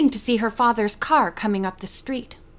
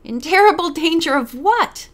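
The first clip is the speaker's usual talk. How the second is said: higher, louder incredulous echo; amused disbelief; 6 semitones higher, 5 dB louder than usual. A narrator's reading. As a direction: throw the warning back as a mocking question. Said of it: "the lawyer burst out laughing".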